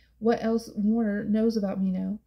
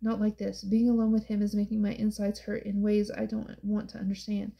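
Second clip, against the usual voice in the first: usual speaking voice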